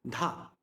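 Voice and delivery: "Dha" short and low; short syllable. Shown as a demonstration. Teacher showing syllable duration, "Dha" kept short. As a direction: drop a brief "Dha", vowel not held. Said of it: "only for a certain amount of time".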